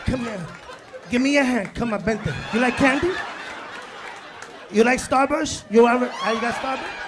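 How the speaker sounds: put-on loud sing-song coaxing like to a toddler. Coaxing the little guy with Starburst, loud and sing-song. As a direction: lure him over with candy.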